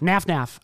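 Naf Naf said with short a, both halves alike; pronounced Naf Naf. Shown as a demonstration. Answering friend how the grill's name is said, Naf Naf with flat a. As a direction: say "Naf Naf" rhyming with laugh. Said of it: "is it actually pronounced Naf Naf".

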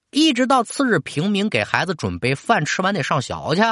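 plain reading voice

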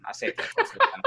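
breathy laughing words. Real, not acted. Laughing, repeats his line.

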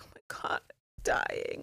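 breathless whispered moan, barely voiced; faint dying whisper. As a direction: fade out like she's dying. Violet dying, retold.

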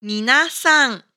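ordinary talk

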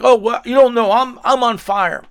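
mock boast. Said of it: "people are like".

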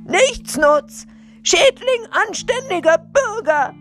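very high, loud outraged shout; shrieking, indignant police captain; yelling after someone. A narrator's reading. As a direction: hurl insults after a fleeing robber. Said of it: "schrie der Polizeihauptmann ihm nach".

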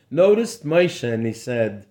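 plain reading voice

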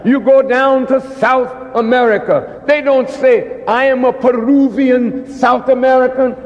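ordinary talk, nothing marked

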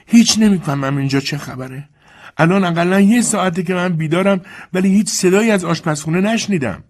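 put-on high, peevish old lady voice; complaining mistress. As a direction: grumble from the bed upstairs. Mrs Compson's voice.

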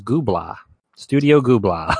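Gubla said as goob-lah, a deliberately wrong Ghibli; joke mispronunciation. Shown as a demonstration. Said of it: "a wrong answer, which is what I'm gonna start saying".

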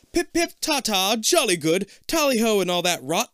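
high, loud hammed-up British; over-the-top British exclamation; best British accent. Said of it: "in his best British accent".

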